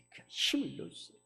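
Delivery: ordinary talk, nothing marked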